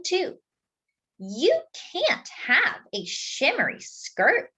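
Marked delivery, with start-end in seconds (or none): 1.2-4.5 high, slow, bossy refusal; firm big sister. Acted. Sister refuses her brother the skirt, slow and bossy. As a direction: lay down the rule to a little brother.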